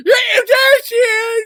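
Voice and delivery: normal talking voice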